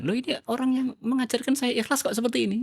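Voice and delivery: menirukan sahabat yang heran, nada lebih tinggi. Retold or imitated. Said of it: "Sampai para sahabat heran". (nada heran yang ditirukan)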